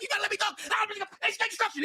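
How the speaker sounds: mimicking angry guest. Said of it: "He was like".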